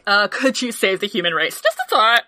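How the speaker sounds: normal talking voice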